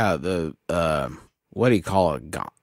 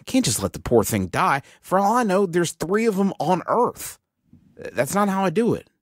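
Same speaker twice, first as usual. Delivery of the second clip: pleading; 9 semitones higher than usual. Acted. Player pleads to save creature.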